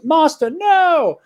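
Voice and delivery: high slow dramatic yell; goofy. Retold or imitated. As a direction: wail it like a bad voice actor. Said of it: "talking about how anime it is".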